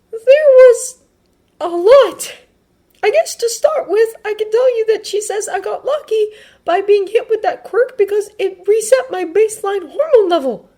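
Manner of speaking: put-on very high, loud, earnest boy's voice; Midoriya explaining. Midoriya telling friends what the nurse said, earnest. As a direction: start haltingly, then explain in a high voice.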